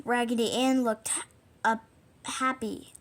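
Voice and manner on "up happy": ordinary delivery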